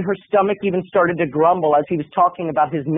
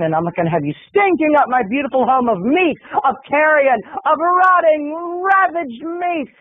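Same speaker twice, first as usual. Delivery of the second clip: building disgust rant; 6 semitones higher than usual. Retold or imitated. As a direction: build the revulsion up and stretch the last words. Roommate's escalating disgust rant.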